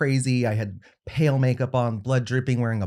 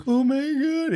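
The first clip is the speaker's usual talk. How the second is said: much higher, put-on squeal of a voice; mock gushing; slower than usual. Grandson reacting to grandmother's gift, high mock gushing. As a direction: go way up high, playfully overdone.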